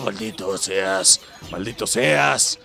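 slow, low, dragged-out curse; low slow theatrical curse; mock villain curse. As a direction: ham up a cartoon villain's damnation. Cursing an enemy.

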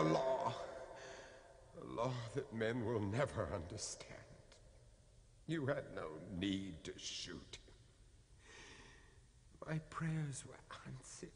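soft, breathy, grieving whisper; whispered grief over the body; hushed whisper. Acted. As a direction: say it barely above breath. Grieving priest speaks hushed.

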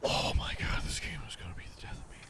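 breathless fast whisper; whispered despair. Real, not acted. Gamer after a near miss, exhausted whisper. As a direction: collapse into a defeated whisper.

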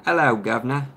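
hammed-up posh British hello; British accent. Shown as a demonstration. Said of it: "can we have your best British accent".

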